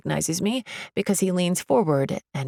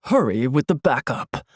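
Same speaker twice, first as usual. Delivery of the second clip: exasperated security guard; 4 semitones lower than usual. A narrator's reading. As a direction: lower guard voice into a walkie-talkie. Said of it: "He sighs and says into his walkie-talkie".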